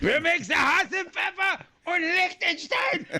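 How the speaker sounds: mock German-French gibberish, laughing and high; horrible fake accent. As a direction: ham up a bad European accent, half shouted through laughter. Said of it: "that was a horrible French accent".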